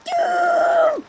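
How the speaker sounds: very high, loud shriek; throat-straining shout. Pretend. Echoing a teammate's "doom", high mock shriek. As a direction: screech it out till the throat hurts. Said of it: "That hurt my throat".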